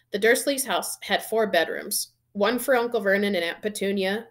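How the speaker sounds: plain reading voice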